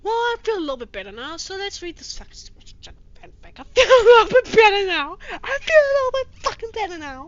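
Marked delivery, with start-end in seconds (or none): 3.6-5.2 yelled out, much louder and higher; a loud sarcastic shout; mock yell. Repeating phrase, yelled. 5.3-7.3 loud, higher and fast; a fast, high shout; mock shout. Repeating phrase, yelled.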